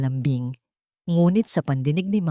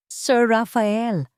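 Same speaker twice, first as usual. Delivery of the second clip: slow drawn-out call, higher; calling to Sir Rafael, slow and a touch higher; 5 semitones higher, much slower than usual. A narrator's reading. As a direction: stretch his name out to catch him. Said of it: "tawag niya".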